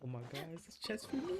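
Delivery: ordinary talk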